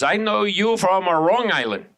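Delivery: imitated woman with Hong Kong accent; mock Hong Kong accent. Hong Kong woman placing the comic, mock accent. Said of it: "She goes".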